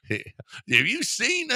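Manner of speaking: very high, slow squeak dissolving into a laugh; laughing falsetto mock. Host mocking the guest in a slow high squeak, laughing. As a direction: ape the guest's high voice until you crack up.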